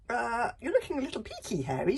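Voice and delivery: Bertha voice. Acted. Bertha speaks politely.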